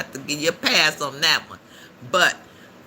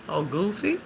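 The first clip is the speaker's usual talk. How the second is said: usual speaking voice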